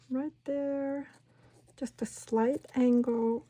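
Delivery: ordinary delivery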